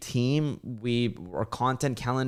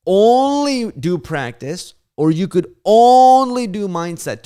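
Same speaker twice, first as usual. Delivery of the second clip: emphatic loud question; 8 semitones higher, 9 dB louder, slower than usual. Real, not acted. Posing a hard either-or question.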